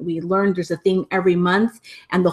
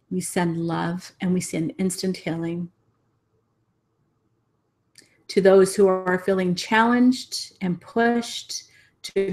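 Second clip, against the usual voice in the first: guided meditation blessing. Real, not acted. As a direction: guide them gently into a closing blessing, breathing between phrases. Leads closing meditation and blessing.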